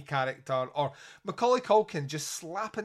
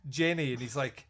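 usual speaking voice

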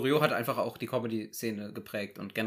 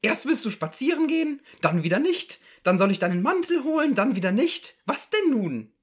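higher, exasperated housewife voice; exasperated wife; 12 semitones higher, 7 dB louder than usual. Acted. Wife complaining to husband, exasperated. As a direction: throw his back-and-forth at him. S0 plays wife in Loriot sketch.

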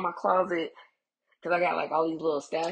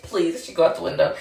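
normal talking voice

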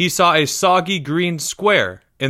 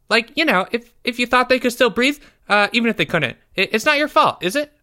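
put-on high, halting boy voice, then rushed; hesitant schoolboy; 10 semitones higher, faster than usual. A narrator's reading. Pupil asking teacher about burying someone, sheepish high voice. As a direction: ask sheepishly in a small high voice. Schoolboy character justifies himself.

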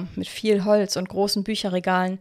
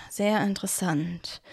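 sehr interessant murmured much softer, to himself; 6 dB quieter than usual. A narrator's reading. Cornelius mulls over the siblings' tale, murmuring to himself. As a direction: mumble sehr interessant under your breath. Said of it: "murmelte Cornelius".

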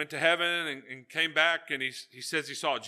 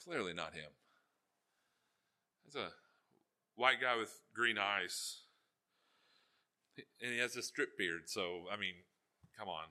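softer, lower, deflated grumble; disappointed muttering; 5 semitones lower, 7 dB quieter than usual. Retold or imitated. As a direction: let the air out of it, unimpressed. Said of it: "And you're like".

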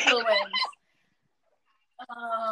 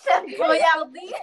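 laughing; 4 semitones higher, 6 dB louder, slower than usual. Real, not acted. Laughing at mispronounced brand.

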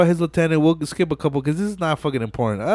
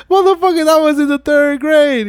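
indignant yell; 12 semitones higher, 6 dB louder than usual. Retold or imitated. Vince protesting loudly.

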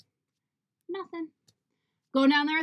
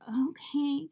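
whispered okay; 4 dB quieter than usual. Retold or imitated. Quoted reply to his drinking plan.

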